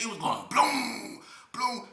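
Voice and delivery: high, slow booming noise imitation; gate banging. Narrator copying the gate noise during the fight, a slow booming imitation. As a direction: drag out the bloom like a crash.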